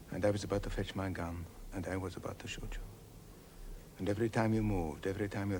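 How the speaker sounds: chilling whisper. Acted. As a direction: confide a dark secret. Confessing murderous thought.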